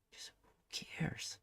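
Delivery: breathy whispered shrug of resignation. Acted. To himself, giving up on the hobby worries, whispered. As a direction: let it fall to a bare whisper. Drops to a whisper after musing.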